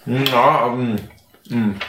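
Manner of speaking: talking through a mouthful of orange; chewing while talking. Real, not acted. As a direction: chew and speak at the same time. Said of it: "ich esse eine Orange".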